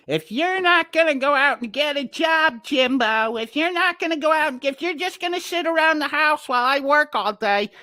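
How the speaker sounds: high nagging mom voice; imitating Jimbo's mom. Jimbo's mom scolding him to get a job, high and nagging. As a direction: scold him in a shrill, high-pitched mother's nag. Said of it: "Jimbo's mom started charging him".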